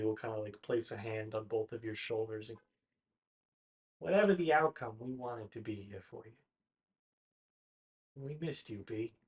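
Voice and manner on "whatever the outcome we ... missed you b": much softer, sincere comfort; tender farewell. Acted. Friends comfort Branwen before her fight, soft and tender.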